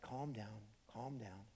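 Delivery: low, very soft reassurance; soothing. Acted. Jesus settles trembling Peter, soothing. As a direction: hush him gently. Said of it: "Jesus is just like".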